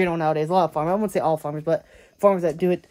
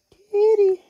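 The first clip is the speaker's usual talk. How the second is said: high, louder call to the kitten; 5 dB louder than usual. Real, not acted. Speaker calling the clinging kitten, high and loud. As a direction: call the cat, pitched way up.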